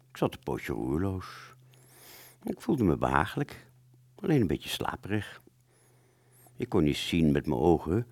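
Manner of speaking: usual speaking voice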